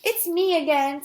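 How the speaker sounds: high, loud singsong lunch lady; Miss Mush. A narrator's reading. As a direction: chirp it out in character. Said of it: "Miss Mush's voice was like two brutes sloshing through the mud".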